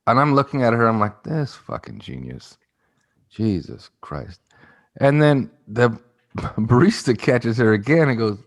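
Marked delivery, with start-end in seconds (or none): 1.2-4.4 muttered under breath. Retold or imitated. Muttered reaction to the girl.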